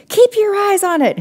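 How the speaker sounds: high, loud command; order. A narrator's reading. Hank ordering Maddie while grabbing the camera, loud. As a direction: bark the order. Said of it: "Hank ordered".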